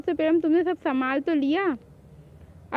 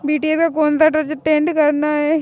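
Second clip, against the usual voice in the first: loud insistent declaration; defiant loud demand; 6 dB louder than usual. Acted. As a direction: stake your claim loudly. Defiant fan demand, quarrel. (ज़िद से ज़ोर से कहना)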